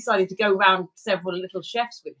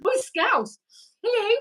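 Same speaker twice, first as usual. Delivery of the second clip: much higher, sing-song mock greeting; 13 semitones higher than usual. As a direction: greet him in a silly high voice. High put-on greeting voice.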